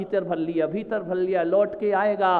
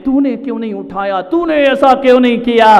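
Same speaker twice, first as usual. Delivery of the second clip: rising, louder accusation; acted scolding; 2 semitones higher, 9 dB louder than usual. Husband blaming wife for not waking him, scolding loudly.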